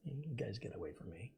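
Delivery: hushed, whispered shooing; mock-secretive whisper; whispered aside. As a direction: whisper them away under your breath. Aside to imaginary friends.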